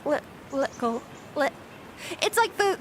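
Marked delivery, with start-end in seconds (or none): 0.0-1.5 halting LI-, LICKLE, lower and careful; retry little with mid-tongue T, breaking off; practicing plosive T. Shown as a demonstration. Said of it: "but it takes practice".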